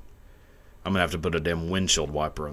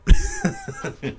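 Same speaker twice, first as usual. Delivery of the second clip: very high startled squeal of a laugh; hammed-up shocked shriek; 4 dB louder than usual. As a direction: mimic her horrified reaction noise. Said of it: "She went".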